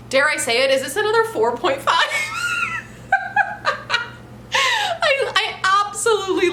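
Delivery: giddy shriek of laughter; laughing shout. Real, not acted. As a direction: burst out laughing in disbelief. Excited laughing shout over rating.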